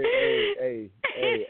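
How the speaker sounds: laughing through the words; real laughter breaking in. Real, not acted. Laughing at host's joke.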